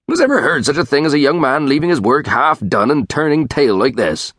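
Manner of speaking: a touch of country indignation; mildly scandalised rustic grumble; indignant tranter. Acted. Tranter complains about Dick.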